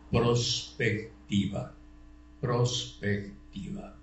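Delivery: very slow, broken into separate syllables; deliberate teacherly repetition, syllables spaced out; syllable-by-syllable dictation. Real, not acted. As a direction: dictate the term slowly, piece by piece. Lecturer dictates a key term.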